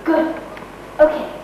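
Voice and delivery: normal talking voice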